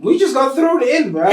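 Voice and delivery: loud, high, slow disbelief; incredulous outburst. Real, not acted. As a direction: blurt it out in amazed disbelief. Incredulous reaction.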